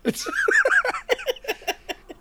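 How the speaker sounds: big burst of high laughter; loud laugh. Real, not acted. Big laugh after joke.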